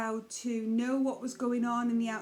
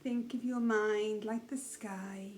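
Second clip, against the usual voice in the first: soft, unhurried meditation voice with long pauses; calm, hushed guidance, leaving space after each phrase; guided meditation; 5 dB quieter than usual. Real, not acted. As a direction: gently guide listeners into awareness of breath and mind.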